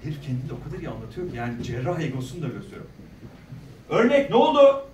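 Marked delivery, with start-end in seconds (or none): 3.9-4.8 imitated angry yell, much louder and higher; shouting. Surgeon yelling at the pathology lab about the sample, shouting. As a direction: bellow the question as the surgeon did. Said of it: "Böyle bağırdım".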